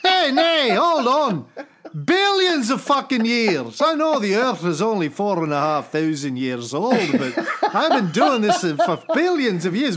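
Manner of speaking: put-on high, blustering, outraged Scottish accent; Scottish God. God telling Sonny off about miracles, outraged Scottish accent. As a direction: scold like an irate, hammed-up Scotsman. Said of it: "I don't know why God is Scottish".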